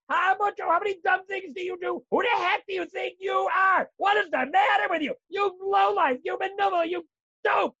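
high, loud scolding of yourself; hammed-up angry self-talk, high and shouting; mock self-berating. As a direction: tear into yourself like a furious critic. Acting a harsh self-scolding inner voice.

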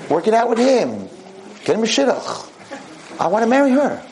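high, indignant groom; protesting son. Retold or imitated. Groom refusing to give up his wedding, high and indignant.

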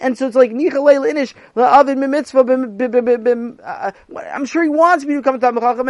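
put-on high, loud, rushing kid voice; guilty pupil pleading with his teacher, high and loud; pleading boy. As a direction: gush out excuses like a desperate boy. Sammy pleads his excuse.

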